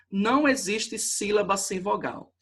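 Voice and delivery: ordinary talk, nothing marked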